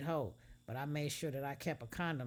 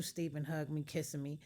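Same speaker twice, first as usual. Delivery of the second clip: ordinary delivery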